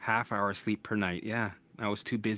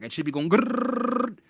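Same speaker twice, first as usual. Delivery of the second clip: imitated racing heartbeat; 5 semitones higher, 6 dB louder, slower than usual.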